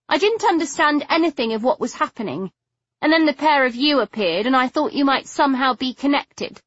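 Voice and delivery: put-on high, distressed young woman; a hint of a contrite girl. Figgy confesses, distressed.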